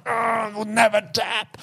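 high, slow, put-on stubborn voice; mock stubborn grappler. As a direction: drag it out like a proud partner who won't give in. Imitating a stubborn training partner.

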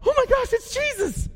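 high-pitched mock excitement; excited recognition. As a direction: blurt it the moment you recognize him. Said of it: "John recognizes first".

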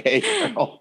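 high breathy mock Hey girl; Ryan Gosling meme. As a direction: tease it like the meme. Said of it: "that's a Ryan Gosling meme".

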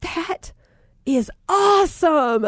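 slow, delighted "awesome" with rising pitch; delighted mom praising her son; delighted praise. Retold or imitated. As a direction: praise him warmly and slowly. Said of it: "And I said".

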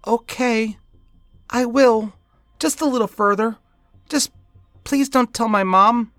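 put-on high child's voice, halting; hesitant little boy. A narrator's reading. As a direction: give in timidly with small pauses.

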